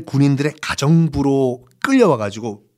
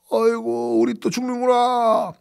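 lamenting prisoner; 9 semitones higher, 4 dB quieter, slower than usual. Acted. Prisoners lament their death.

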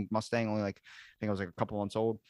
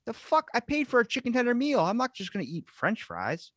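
much higher, mock outraged rant; retold indignation, pitched way up; indignant; 13 semitones higher than usual. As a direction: complain like you were robbed of chicken. And I'm like.

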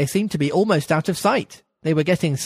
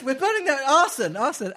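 Rabbit's voice; 7 semitones higher than usual. Acted. The rabbit goes on.

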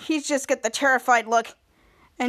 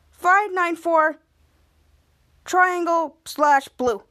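slow made-up answer; 3 semitones higher, 3 dB louder, much slower than usual. Retold or imitated. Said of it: "Captain Man lied and said".

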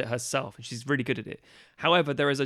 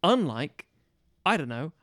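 usual speaking voice

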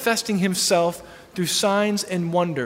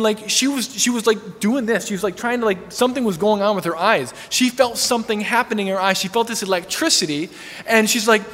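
usual speaking voice

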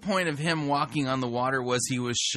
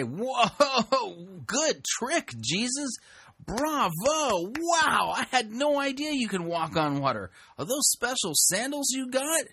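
high-pitched, hammed-up wonder; mock amazement; 8 semitones higher, slower than usual. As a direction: applaud a magic trick in a high, gushing voice. Mock reaction to walking on water.

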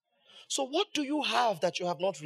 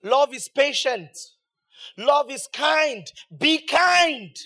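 rising, louder preacher's cadence; preaching; 5 semitones higher, 6 dB louder, slower than usual. Real, not acted. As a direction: preach it out to the congregation. Preaching on love.

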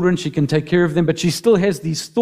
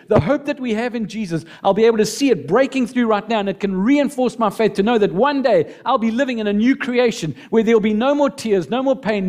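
fervent prayer; 5 semitones higher than usual. Pretend. Voicing a prayer to God.

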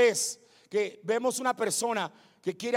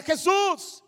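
high, raised call of the name; crying out; 8 semitones higher, 4 dB louder than usual. Acted. Blind man starts shouting to Jesus, crying out. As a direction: call to someone passing by. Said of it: "empezó a gritar".

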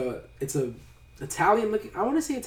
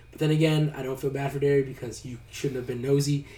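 ordinary talk, nothing marked

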